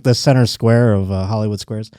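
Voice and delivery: normal talking voice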